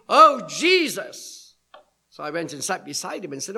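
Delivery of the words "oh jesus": put-on loud, drawn-out drunk man's outburst; drunken shout. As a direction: shout it out long, imitating a drunk. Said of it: "he just shouted".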